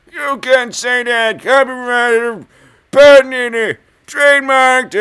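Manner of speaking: high, loud, slow protesting voice; mock lawyer objection.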